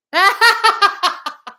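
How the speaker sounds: high, loud cackling laugh; hearty laugh. Real, not acted. Telling story of disappointed dog, hearty laugh. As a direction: burst out laughing.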